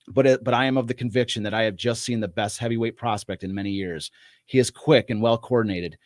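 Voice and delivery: measured narration with falling phrase endings; formal, even-paced story narration; screenplay read-aloud. Acted. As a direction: read it out like an old fight report.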